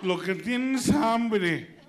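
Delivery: put-on the pediatrician announces the baby is only hungry; pediatrician's verdict. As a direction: drag out the diagnosis like a pompous doctor. Pediatrician voice in joke.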